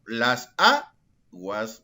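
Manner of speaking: very slow, "aguas" split after a strong A; stress on A. Shown as a demonstration. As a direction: draw out "las aguas", lifting and punching the first A. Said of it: "mayor fuerza de voz". ("aguas" muy lento, separando y marcando la primera A)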